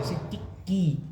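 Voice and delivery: slow demo, कि clipped short then की held twice as long; short and long vowel demo. Shown as a demonstration. Teacher showing students short and long vowel, slowly. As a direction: stretch की to double the length of कि. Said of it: "ह्रस्व की तुलना में दो गुना समय लगेगा".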